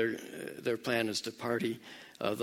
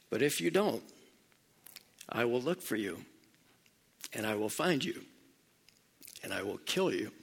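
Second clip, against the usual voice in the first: retold quiet threat; 2 semitones lower, 5 dB quieter than usual. Father warning his daughter's kidnapper on the phone, quietly menacing.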